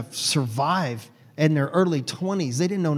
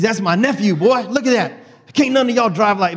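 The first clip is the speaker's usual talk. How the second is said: loud, high boasting holler; uncle bragging; 5 semitones higher, 5 dB louder than usual. Retold or imitated. Uncle boasting to produce managers about his nephew, loud. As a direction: brag to the whole crew.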